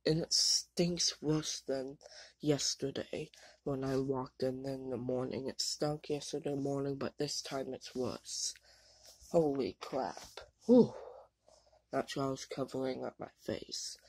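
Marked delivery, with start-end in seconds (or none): none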